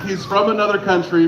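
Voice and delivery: ordinary talk, nothing marked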